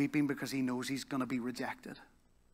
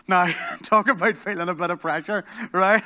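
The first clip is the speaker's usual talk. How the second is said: laughing; 5 semitones higher, 10 dB louder than usual. Real, not acted. Laughing at the pressure moment.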